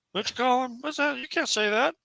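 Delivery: a high, scandalized outsider asking "What's that?"; shocked outsider. Retold or imitated. Mocking an outsider shocked by the nickname, pitched high. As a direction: play the appalled out-of-towner, voice pitched high.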